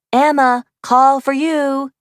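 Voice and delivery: staged call to someone far away. A narrator's reading. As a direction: call across the room for the phone's sake. Calls out across room.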